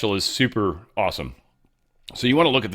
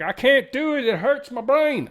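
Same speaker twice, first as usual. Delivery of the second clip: much higher, whining like an overwhelmed viewer; mock whiny complaint; 3 dB louder than usual. Mocking a viewer who gives up on the idea, high whining. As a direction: whine it as a baby-voiced cop-out. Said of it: "and you're like".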